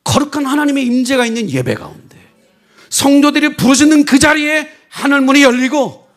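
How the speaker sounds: loud, high, urgent preaching; fiery preaching. Acted. Pastor preaches fervently to the congregation about spiritual power. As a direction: proclaim it with rising fire to the congregation.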